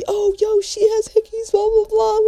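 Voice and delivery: high-pitched gossipy clamor; mocking crowd imitation. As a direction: mimic everyone making a big deal, very high. Crowd making a big deal.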